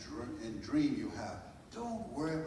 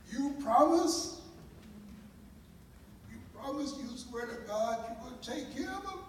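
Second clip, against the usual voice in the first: desperate pleading; 12 semitones higher, 5 dB louder than usual. Retold or imitated. Birth mother begging Mama at the car window, pleading. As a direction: beg for reassurance, voice up high.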